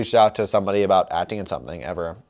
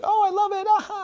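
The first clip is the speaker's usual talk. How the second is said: gushing fan voice. Retold or imitated. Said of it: "and they're like".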